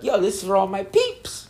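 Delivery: high teasing mimic of the line; mocking imitation. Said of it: "He was like".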